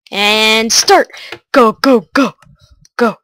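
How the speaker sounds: rally yourself to start; excited. Real, not acted. Starting the timer.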